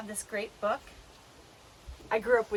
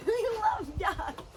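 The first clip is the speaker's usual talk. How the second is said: laughing; 11 semitones higher, slower than usual. Real, not acted. Laughing at kids' delight.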